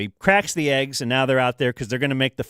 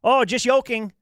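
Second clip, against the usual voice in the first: much higher mock chef voice; imitating the chef's pun; 10 semitones higher than usual. Chef jokes after faking the toss, higher mock voice. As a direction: sell the pun like a showman. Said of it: "he goes".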